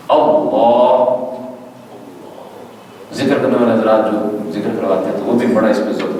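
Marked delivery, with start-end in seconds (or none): 0.1-1.2 اللہ very slow, sung, with a full heavy lam; sung اللہ with tafkheem; drawn-out heavy Allah. Shown as a demonstration. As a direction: stretch اللہ long like in zikr. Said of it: "یہ تلفظ".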